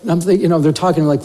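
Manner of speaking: usual speaking voice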